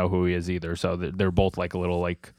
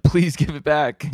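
higher, drawn-out imitation of begging; mock pleading; 6 semitones higher, 3 dB louder, slower than usual. He bows to get his suitcase back, mock pleading. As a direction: plead in hammed-up desperation.

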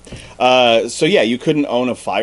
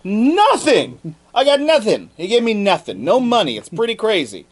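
put-on high, whiny complaining boy; whiny kid; 10 semitones higher than usual. The boy lying to his friends about the king's reward, high whiny voice. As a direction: whine to your friends about the stingy king, pitched way up. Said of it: "he was like".

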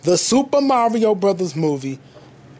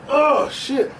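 usual speaking voice

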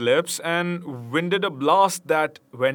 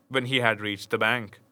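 ordinary delivery

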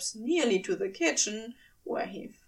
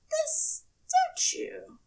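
high, slow, awed angel voice; the angel staring at a statue, slow and high; Aziraphale, stunned; slower than usual. Acted.

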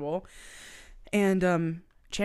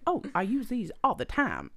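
higher-pitched, badly faked Southern accent; attempted odd Southern accent; 5 semitones higher than usual. Explaining away the tampons as Sebastian, shaky-fake Southern accent. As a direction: put on a weird Southern accent, half-laughing. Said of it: "I don't even know how to do her accent".

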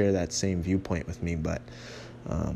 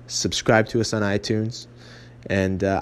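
normal talking voice

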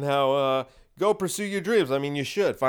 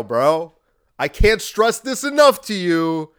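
ordinary delivery

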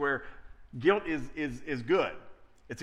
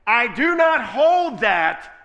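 slow, booming and much louder; voice of God; 9 dB louder, much slower than usual. Acted. Preacher voicing God forgiving believers, slow booming proclamation. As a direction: proclaim forgiveness slowly and loudly.

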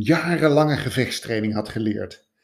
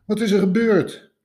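put-on concerned old man; 11 semitones higher, 5 dB louder than usual. A narrator's reading. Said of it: "vroeg de oude man".

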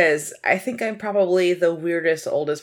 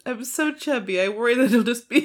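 ordinary talk

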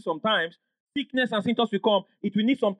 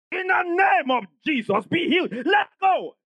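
high, forceful healing command; re-enacted shout down the phone; shouted prayer; 8 semitones higher than usual. Pastor shouting healing at sick caller on phone, shouted command. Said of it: "I shouted back on the phone".

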